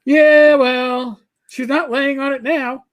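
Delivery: high, loud drawn-out imitation; mock calling-out voice; Rach's voice. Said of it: "when I hear Rach go".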